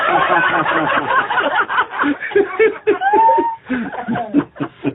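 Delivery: big burst of high laughter. Real, not acted. Laughter after punchline.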